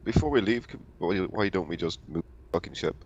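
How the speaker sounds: usual speaking voice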